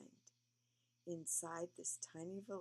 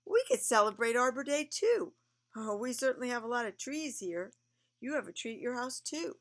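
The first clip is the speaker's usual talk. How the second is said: excited; 8 semitones higher, 13 dB louder than usual. Real, not acted. Enthusing to the child.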